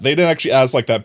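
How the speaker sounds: ordinary delivery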